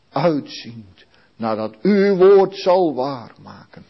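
ordinary talk

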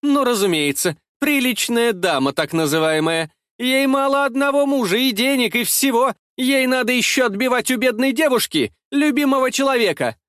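high-pitched, coarse tirade; jeering, unceremonious accusation; rude shouting. A narrator's reading. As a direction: rail at the married rival with open scorn. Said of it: "крикнула она грубым голосом".

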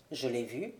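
very soft, low, short answer; subdued admission. A narrator's reading. Subdued answer under questioning. (aveu bref, très bas)

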